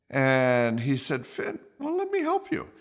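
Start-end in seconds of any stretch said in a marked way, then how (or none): 1.2-2.7 much higher, softer, gentle friend's voice; a high, soft, offering tone; voicing a friend. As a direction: play the kind friend, light and high.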